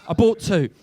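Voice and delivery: normal talking voice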